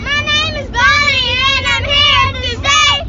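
window down on highway. Real, not acted. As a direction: rhyme called out the car window. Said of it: "Scream out the window".

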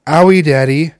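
imitating his small son in pain; child crying owie; little kid voice. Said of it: "He goes".

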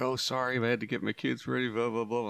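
lightly mocking a harried parent's excuse; whiny parent excuse, lightly put on. Host mocking other parents' late excuses, in a whiny voice. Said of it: "People like".

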